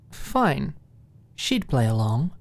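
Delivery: ordinary delivery, nothing marked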